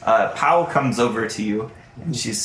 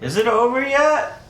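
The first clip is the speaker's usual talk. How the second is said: high heckler's call from the crowd; mock shout; 12 semitones higher than usual. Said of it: "hear someone shout at him".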